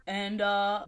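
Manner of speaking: usual speaking voice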